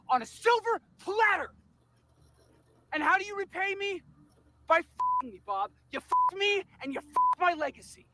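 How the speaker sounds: crying with rage. Acted. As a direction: yell it, voice cracking with fury. Boss raging at betrayal.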